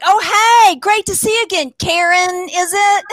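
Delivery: high, fast chirpy fake-friendly greeting; people greeting her as Karen. Strangers mistaking her for a Karen, high fake-friendly greeting. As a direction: gush like a stranger who mistakes you for a Karen. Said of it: "and they're like".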